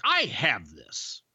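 slow, confident imitation of Paul; imitating Paul. Telling the crew how Paul said it, slow and sure. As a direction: drawl it out like a smug boss. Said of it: "Paul's like".

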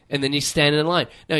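usual speaking voice

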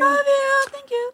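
high loud gushing thanks. Real, not acted. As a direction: squeal the thanks. Thanking sponsor.

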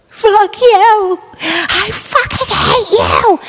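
high, loud mock scream, slowing at the end; imitated screaming. Mimicking the neighbor yelling at her dog, high mock scream. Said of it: "you could hear her going".

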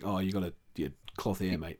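low, soft mock grumble; Russell Crowe. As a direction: imitate an irritated interviewee under your breath. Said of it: "interview he walked out of going".

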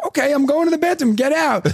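grandma shooing. Retold or imitated. Nana in the bathroom shooing her grandson out, mildly annoyed. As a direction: shoo the boy out quickly. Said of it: "So she goes".

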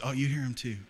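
normal talking voice

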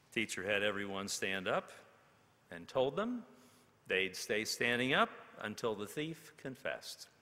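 usual speaking voice

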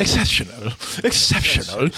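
exaggerated British accent led by the top lip; mock a bad British accent with an overworked top lip; mimicked British accent. Mimicking the actor's line just heard, mock British accent. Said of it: "You see that top lip".

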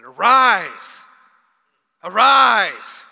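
booming, high, loud command to rise; commanding shout. Acted. Jesus commanding the dead young man, booming shout. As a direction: call the dead man up with full force.

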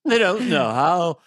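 slow, drawn-out Liverpool lilt. Acted. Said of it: "doing a Beatle accent".

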